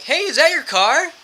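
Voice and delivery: high, loud, slow bait; overly cheery sudden question; fake bright distraction. Distracting drunk man, fake cheer.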